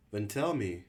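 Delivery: a forced-calm call across the crowd. Acted. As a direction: call out, fighting to stay steady. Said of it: "Thomas called out".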